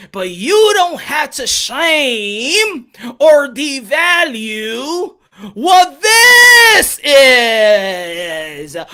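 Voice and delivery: sing-song, very slow and deliberate; slow repeat. Shown as a demonstration. Repeating his point to critics, sing-song and very slow. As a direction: drag every word out like a lesson. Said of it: "I'll say it again".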